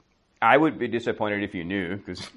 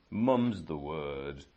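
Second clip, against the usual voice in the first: slow British English, a bit softer; British accent; 5 semitones lower, 5 dB quieter, much slower than usual. Shown as a demonstration. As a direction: savour the idiom slowly in British English. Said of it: "in British accent".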